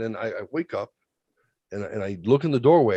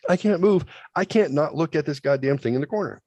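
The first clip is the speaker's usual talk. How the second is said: usual speaking voice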